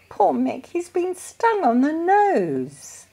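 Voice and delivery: pitying. A narrator's reading. Peter pities stung puppy.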